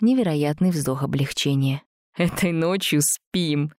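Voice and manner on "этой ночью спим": slow, blissful smiling voice. A narrator's reading. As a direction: say it with relieved bliss. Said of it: "блаженно улыбнулся Жак".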